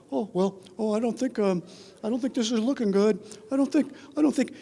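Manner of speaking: rising, nervous student voice; student losing his nerve on approach. Retold or imitated. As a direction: let the pitch creep up as panic sets in. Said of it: "his voice starts to get a little higher".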